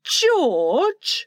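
pained wifely reproach; pained exclamation. A narrator's reading. Wife to her husband, pained. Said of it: "Missus Darling exclaimed, pained".

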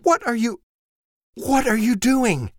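high, loud stammering demand; wife, high and appalled; aghast wife. Acted. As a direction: sputter it out, scandalized. Wife confronting husband.

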